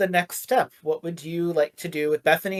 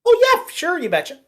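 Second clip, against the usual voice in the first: high-pitched Midwestern folksy reassurance; Midwest accent; 11 semitones higher than usual. Acted. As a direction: lay on a chirpy Midwest "you betcha". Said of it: "That's so Midwest".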